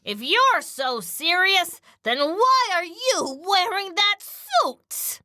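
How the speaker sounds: high, drawn-out indignant challenge; hammed-up comeback, swooping up; theatrical retort. Trainer telling off the studio boss, theatrical. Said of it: "Well, let's say".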